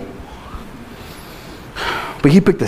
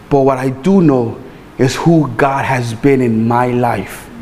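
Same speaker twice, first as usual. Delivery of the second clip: ordinary delivery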